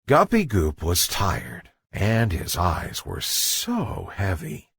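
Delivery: ordinary delivery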